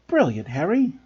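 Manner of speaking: plain reading voice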